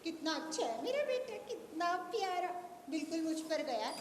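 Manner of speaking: soft doting mother; hushed, adoring baby talk; tender cooing. Acted. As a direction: coo quietly over your baby. Mother coos over her baby.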